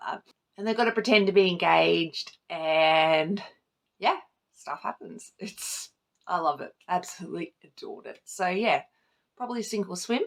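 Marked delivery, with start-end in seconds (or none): none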